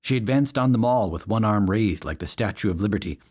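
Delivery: plain reading voice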